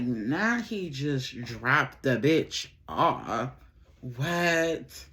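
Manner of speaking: stunned disbelief. Retold or imitated. Telling her friend she can't believe he dropped her off, stunned. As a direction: spell it out slowly, stunned. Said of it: "I was in shock".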